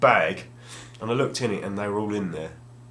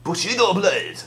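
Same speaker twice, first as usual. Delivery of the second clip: announcing title; 9 semitones higher, 6 dB louder, slower than usual. Real, not acted. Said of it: "first one".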